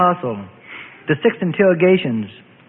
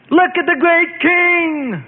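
high, slow sneering voice; mocking priest; 14 semitones higher, slower than usual. A narrator's reading. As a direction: jeer at the fallen king. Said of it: "the chief priest mocked".

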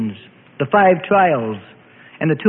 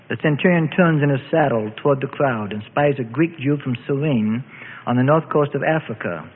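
plain reading voice

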